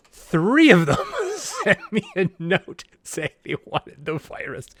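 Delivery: GM cracks up mid-sentence at the memory; laughing. Real, not acted. GM laughing as he tells friends about players' secret notes.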